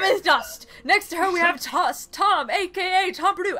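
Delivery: loud, high contestant introductions; enthusiastic race announcer. Pretend. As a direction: call out the racers with big announcer hype. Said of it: "the very enthusiastic".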